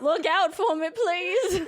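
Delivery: high, loud pleading prayer; mock prayer. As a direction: beg God for rescue, laughing. Mock prayer to God while bleaching.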